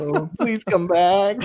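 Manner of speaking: slow, high pleading through laughter; drawn-out, giggly fake begging; laughing mock plea. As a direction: beg playfully as you laugh. Mock begging the departing co-host to stay.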